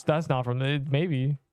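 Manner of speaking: ordinary delivery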